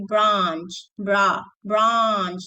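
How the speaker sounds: branch with a long open ah, slowly; British branch. Shown as a demonstration. Teacher shows students branch in British, broad ah. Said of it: "If you're doing British, you say".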